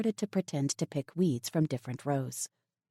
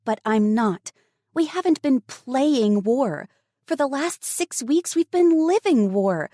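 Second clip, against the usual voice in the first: put-on much higher, louder defiant teen girl; 9 semitones higher, 6 dB louder than usual. A narrator's reading. Daughter defying her father over the radio, high and firm. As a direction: stand up to dad, firm and fierce.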